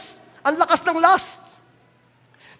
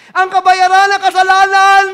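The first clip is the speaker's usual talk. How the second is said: normal talking voice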